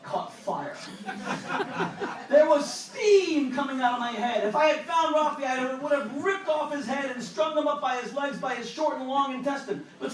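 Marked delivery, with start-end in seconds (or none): 2.3-9.9 hammed-up raging rant, higher and louder; mock fury. Narrator retelling his rage at Rafi, mock furious. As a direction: boil over comically.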